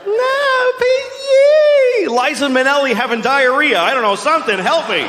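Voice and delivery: high forced cheery squeal; strained cheer. Acted. Man fighting orgasm, high forced cheery squeal. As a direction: squeal it out, barely holding on. Mocking strained guy's thoughts.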